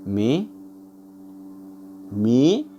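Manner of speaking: "मे" alone, drawn out and leaned on; stressed first syllable. Shown as a demonstration. Teacher isolating the stressed syllable, long pressed मे. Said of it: "एक stress है".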